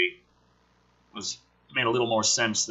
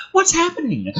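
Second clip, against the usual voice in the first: high startled cry of alarm; alarmed question. Acted. Scientist hearing the alarm, startled and high. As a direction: asks in sudden panic.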